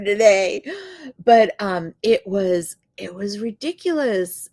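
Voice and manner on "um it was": ordinary talk, nothing marked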